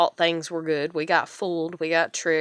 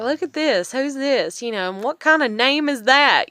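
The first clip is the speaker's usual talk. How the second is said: amused teasing; 7 semitones higher, 4 dB louder than usual. Retold or imitated. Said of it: "But I remember being like".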